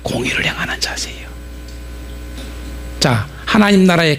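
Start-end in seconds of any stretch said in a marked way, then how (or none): 0.0-1.3 whisper. Shown as a demonstration. Preacher repeating the key phrase to the congregation, whispered. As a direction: repeat 공의를 행하는 자세예요 in a breathy unvoiced hush. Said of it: "맞지요?".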